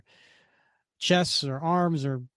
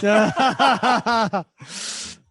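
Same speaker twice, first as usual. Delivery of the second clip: loud real laugh at a friend's bit; hearty laugh; 8 semitones higher, 7 dB louder than usual. Real, not acted. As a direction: burst out laughing. Laughing at co-host's voice.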